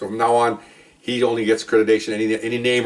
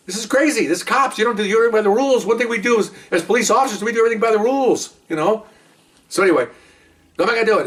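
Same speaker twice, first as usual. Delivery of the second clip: much higher, worked-up insistence; indignant; 10 semitones higher than usual. Real, not acted. Telling listeners about the golf spat, worked up and higher. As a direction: insist it's crazy, by the rules.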